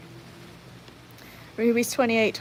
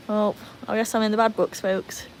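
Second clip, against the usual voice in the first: ordinary talk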